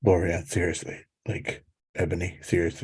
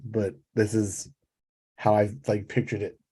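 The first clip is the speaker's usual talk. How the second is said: ordinary talk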